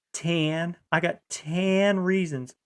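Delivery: Texan "tan" for ten, stretched out; slow, drawn-out Texas vowel on "tan"; Texas accent. Retold or imitated. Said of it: "they'll say tan instead of ten".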